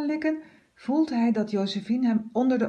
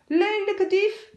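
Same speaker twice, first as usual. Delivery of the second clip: high, louder slow angry scold; scolding cleaner; 11 semitones higher, 5 dB louder, slower than usual. A narrator's reading. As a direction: berate the thief. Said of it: "scheldt ze". (een hoog, luid scheldende werkster)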